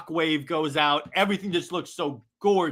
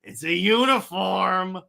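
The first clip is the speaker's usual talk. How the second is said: indignant correction, loud and drawn out; Green Lantern; 3 semitones higher, 5 dB louder, slower than usual. Acted. Hero objects to president calling it costume, loud indignation. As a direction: stretch it out in mock offence. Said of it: "Green Lantern goes".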